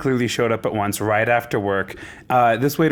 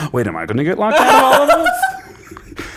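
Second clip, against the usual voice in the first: mock panic; 8 semitones higher, 5 dB louder than usual. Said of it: "I was like".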